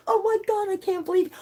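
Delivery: can't believe it; excited disbelief. Real, not acted. Streamer hears of a viewer from London, excited.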